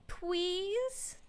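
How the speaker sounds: high, drawn-up begging question; pleading. Acted. Detective begs ma'am about the lynching, high pleading. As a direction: plead on a high rising note.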